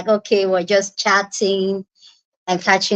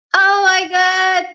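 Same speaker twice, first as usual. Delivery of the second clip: excited squeal; 9 semitones higher, slower than usual. Real, not acted. Overjoyed as friends join stream.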